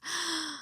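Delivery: sharp quiet gasp of shock; startled gasp. Retold or imitated. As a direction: catch your breath in surprise. Hears the man start weeping.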